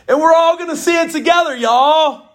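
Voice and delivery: excited Southern preacher call; Southern flourish. Acted. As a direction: lean into the Southern y'all. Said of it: "in the South".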